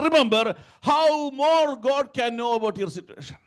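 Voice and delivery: high, urgent sermon delivery; full-force preaching. Acted. Preacher assuring believers God knows their situation, forceful preaching.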